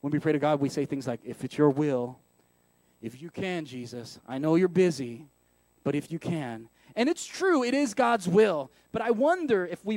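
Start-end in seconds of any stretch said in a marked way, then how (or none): 1.2-6.7 low, downcast mumbled prayer; acted glum, deflated pleading. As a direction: pray it with no hope at all. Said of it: "in a very glum way".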